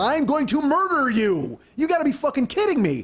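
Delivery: high-pitched, exasperated rant; acted outrage. Host rages at car-wrecker's holiday post, high acted outrage.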